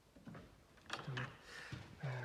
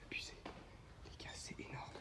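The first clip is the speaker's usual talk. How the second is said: awed whisper; 15 dB quieter than usual. Real, not acted. Awed whisper in dark room.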